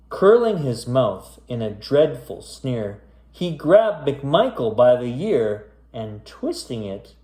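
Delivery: spooky rhyming storybook read; story narration. A narrator's reading. Back to reading the creepy rhyme to kids, storyteller voice. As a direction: pick the tale back up, storyteller voice.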